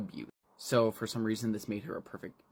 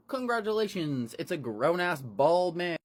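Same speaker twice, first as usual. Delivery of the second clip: mock announcement; 7 semitones higher, 4 dB louder than usual.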